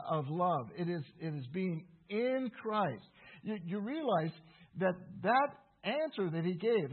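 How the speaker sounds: ordinary delivery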